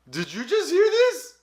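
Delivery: high, slow, stunned whisper-like ask; playing up the shock to a friend; stunned disbelief. To Marco after hearing the waitress's name, stunned and high. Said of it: "I looked at Marco like".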